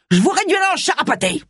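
high, furious character voice; raging threat. A narrator's reading. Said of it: "ragea Harry". (menace rageuse, voix aiguë)

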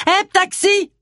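high, sustained hail; calling out. Acted. Agatha hailing a taxi in the street, calling out. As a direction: call sharply to a passing cab.

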